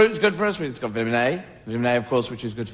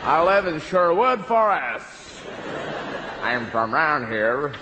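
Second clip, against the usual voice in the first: put-on American accent; slower than usual.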